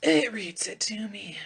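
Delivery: hushed confiding whisper. Real, not acted. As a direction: let it slip in a whisper. Confiding aside about laptop.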